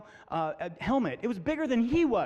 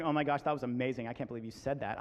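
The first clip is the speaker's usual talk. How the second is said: low, rapid-fire mock gushing; fawning crony girl; 9 semitones lower, 4 dB quieter, faster than usual. Crony girl backing up the lead girl, fawning. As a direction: imitate a sidekick sucking up. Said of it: "totally backed up by the crony".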